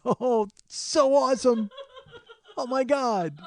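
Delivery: high, stretched-out gushing with a laugh; hammed-up fan rapture; mock awe. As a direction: gush slowly, way over the top. Mocks awe at the wizard robe.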